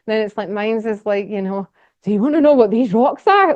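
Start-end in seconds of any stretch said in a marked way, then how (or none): 2.0-3.5 higher, louder eager question; excited presenter teasing the rocks; enthusiastic. Shown as a demonstration. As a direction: hook them with real enthusiasm. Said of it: "it's enthusiastic".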